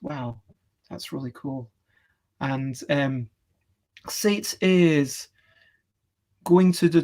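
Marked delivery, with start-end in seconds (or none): 0.0-1.7 hushed, low-key wonder; quiet awe. Retold or imitated. Recalling one image they gazed at, quiet awe. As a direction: say it softly and lower, as if awed.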